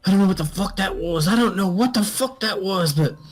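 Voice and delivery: shocked soldier. Acted. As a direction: blurt it out, shaken and high. Player voices shocked soldier.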